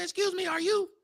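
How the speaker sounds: very high-pitched imitation of a woman; imitated woman. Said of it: "She said".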